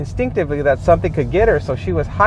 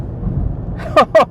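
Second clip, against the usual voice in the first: exasperated laugh; 8 semitones higher than usual. Real, not acted. Driver fuming over wrong miles.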